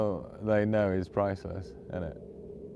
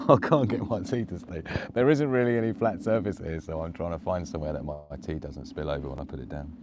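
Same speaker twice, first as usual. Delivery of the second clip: ordinary delivery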